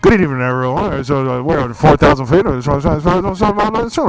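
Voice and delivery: loud pilot cabin announcement; airplane pilot voice. Pretend. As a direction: play the captain over the intercom. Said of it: "sound like an airplane pilot".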